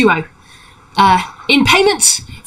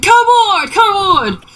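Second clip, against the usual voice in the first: high, excited beckoning call; halfling calling aboard; 11 semitones higher than usual. Acted. Halfling waving travelers aboard, high excited call. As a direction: wave them in with a high cry. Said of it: "he waves it at you like".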